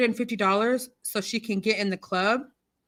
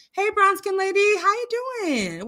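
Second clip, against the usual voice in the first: high-pitched, drawn-out hello; bright greeting; 10 semitones higher than usual. Real, not acted. Livestream host greeting a viewer, high drawn-out hello. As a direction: sing out a big high hello.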